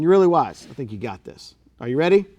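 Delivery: usual speaking voice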